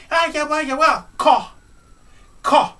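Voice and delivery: high, squeaky put-on voice; playing her. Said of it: "She goes".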